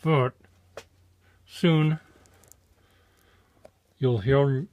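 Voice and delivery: talking around tacks in the mouth. Real, not acted. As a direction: mumble through a mouthful of tacks. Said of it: "It's not easy for me to talk because I have tacks in my mouth".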